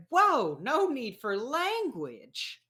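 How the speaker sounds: high-pitched, drawn-out scolding; recoil, then chide with swooping pitch; startled reproach. Acted. Scolding after she swears.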